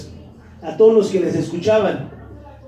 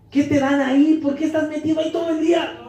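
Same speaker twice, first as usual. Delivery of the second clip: mocking scoffer; 5 semitones higher, faster than usual. Mocking scoffers questioning the believer.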